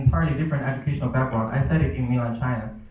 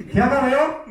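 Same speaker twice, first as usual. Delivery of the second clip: much higher mock-angry teacher's demand; scary scolding; 11 semitones higher, 4 dB louder than usual. Shown as a demonstration. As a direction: scold the pupils in Urdu, pitched right up. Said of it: "I can see some of you getting scared".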